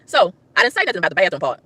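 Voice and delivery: normal talking voice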